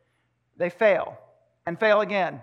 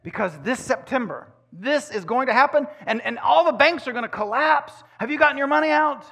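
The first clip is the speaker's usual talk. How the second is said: higher, louder, urgent friend warning of doom; alarmed conspiracy friend; 6 semitones higher, 3 dB louder than usual. Retold or imitated. Preacher mimicking his conspiracy-theorist friend's urgent warnings. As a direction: sell the panic like a worried friend. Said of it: "they'll say".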